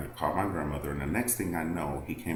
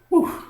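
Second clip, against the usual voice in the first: loud relieved whew; 10 dB louder than usual. Real, not acted. Relief after hitting attacker.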